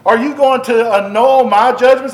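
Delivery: usual speaking voice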